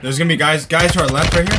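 loud warning callout; urgent callout. Real, not acted. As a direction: call out the enemy position. Calling out enemy positions.

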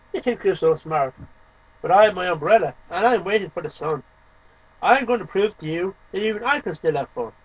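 ordinary talk

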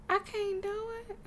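high-pitched helpless whine; near-tears whine. Real, not acted. Player unable to do the holiday, high mock-tearful whine. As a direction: squeak it as if about to cry. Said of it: "I'm about to C R Y".

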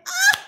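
short, very high, loud shriek; short squeal. Real, not acted. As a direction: burst into a sudden high squeal. Squeal at game character.

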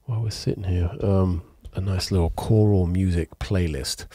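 ordinary talk

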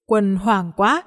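ordinary delivery

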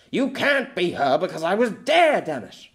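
furious, loud outburst; angry roar. Acted. Pierre shouting at the girl, furious roar. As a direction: roar it as the anger boils over. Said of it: "Pierre roared as his anger boiled over".

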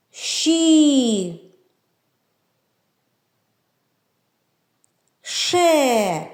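each syllable Аш, ош, уш, іш, еш drawn out, sh held; syllable reading. Shown as a demonstration. Teacher reading syllables aloud for children, drawn out. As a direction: read slowly syllable by syllable. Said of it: "буква Ш буде першою у складах".

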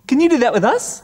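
ordinary talk, nothing marked

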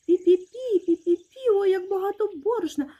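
high, loud excited mouse voice; piping mouse delight; squeaking mice. A narrator's reading. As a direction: squeal with wonder like tiny mice.

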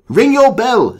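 high British command; British doctor calling out. Acted. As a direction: call urgently to a passer-by. British doctor calls to boy.

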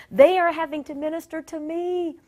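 high, loud exasperated wail; imitating panicked dismay; mock-anguished protest; distressed. Her anguished thought on the plane.

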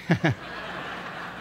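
short unvoiced laugh; soft breathy chuckle. Real, not acted. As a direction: let out a quiet laugh. Laughing at taunt.

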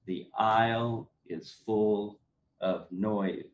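slow, each stressed beat marked; iambic beat. Shown as a demonstration. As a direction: read it out ba-bump by ba-bump. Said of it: "So that you've got ba-bump".

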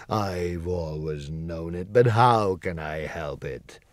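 low, slow, helpless lament; a big beast confessing his weakness; ashamed. Acted. The lion confesses his cowardice to Dorothy, low and slow. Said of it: "hanging his head in shame".

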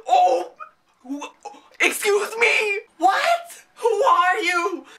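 high, slow, crying-out outrage; high, slow mock-tearful shout; indignant wail. Real, not acted. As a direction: wail at the mother in disbelief. Indignant rant at a character.

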